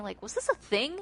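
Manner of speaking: ordinary talk, nothing marked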